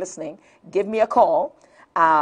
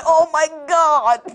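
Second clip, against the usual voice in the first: slow, loud shocked cry; mock horror at the toothless man; 8 semitones higher, 5 dB louder, slower than usual. Recalling her reaction to the man, loud shocked exclaim. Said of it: "I said".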